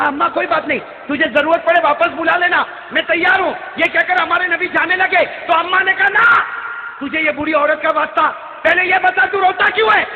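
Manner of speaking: high, pressing declamation; impassioned preaching. Acted. Old woman begging to know why he cries, impassioned.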